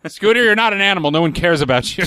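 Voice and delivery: fast, high scolding; mock Muppet boss. Playing a Muppet dismissing Scooter, fast and high. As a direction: brush him off like a Muppet bigwig.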